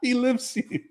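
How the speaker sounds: squeaky laughing delivery. Retold or imitated. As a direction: giggle through the punchline. Replaying movie punchline, laughing.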